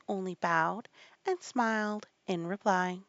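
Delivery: usual speaking voice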